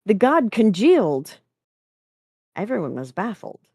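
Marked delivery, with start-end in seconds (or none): 0.0-1.4 loud, high startled outburst; startled gasp. A narrator's reading. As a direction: blurt it out as if jolted awake. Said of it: "produced a full-body spasm and gasped".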